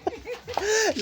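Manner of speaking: short squealing laugh; high laugh. Real, not acted. Collector laughs mid-tease, short high laugh. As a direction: let a giggle burst out high.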